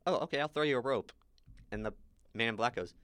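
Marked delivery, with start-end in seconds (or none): 0.1-1.1 quick, higher and louder; mock-obliging, rushed and raised; obliging offer. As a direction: pipe up with a helpful offer. Inigo cheerily offers the climber help.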